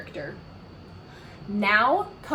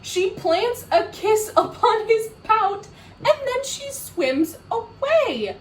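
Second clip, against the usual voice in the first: much higher and louder telling; excited narration; 11 semitones higher, 6 dB louder than usual. A narrator's reading. Reader revealing the kiss, excited. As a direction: build up the big surprise. Climax of the story.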